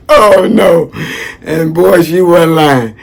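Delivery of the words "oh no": 좀 높게 과장된 절망; mock dismay. Real, not acted. Dread at bigger needle.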